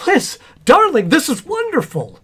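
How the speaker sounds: high, loud burst of delight; overjoyed. Acted. Husband learning of the baby booties, overjoyed. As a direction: exclaim in thrilled wonder.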